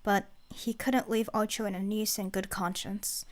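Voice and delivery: plain reading voice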